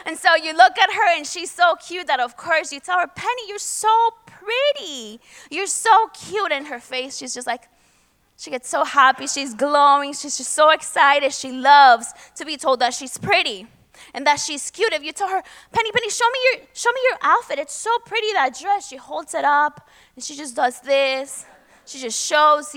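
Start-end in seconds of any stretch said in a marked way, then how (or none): 3.1-6.5 put-on higher, sing-song praise for a little girl; cooing at a toddler. Uncle gushing over his little niece Penny, higher cooing. 15.7-18.9 put-on much higher sing-song coaxing of a toddler; baby talk. Uncle coaxing niece Penny to show her dress, high baby talk.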